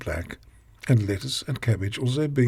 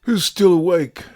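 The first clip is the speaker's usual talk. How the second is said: calling out; 6 semitones higher, 8 dB louder than usual. Acted. Calling out to the listener.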